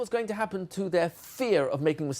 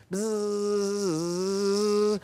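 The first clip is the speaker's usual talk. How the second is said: long, steady voiced buzz; imitated buzz. Shown as a demonstration. Teacher buzzing round the board in a letter game, steady buzz. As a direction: buzz like a bee on one note.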